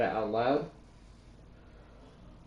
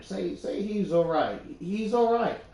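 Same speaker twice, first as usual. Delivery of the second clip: higher-pitched, hopeful plea; anxious pleading; 7 semitones higher than usual. Acted. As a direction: plead for good news, pitch climbing. Hoping aloud for good news of Sam.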